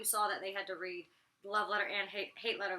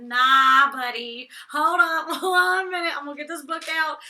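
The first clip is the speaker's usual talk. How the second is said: loud, higher, drawn-out mock protest; heated comeback; 5 semitones higher, 14 dB louder than usual. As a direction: call them out loudly, playfully indignant. Acted comeback in an argument.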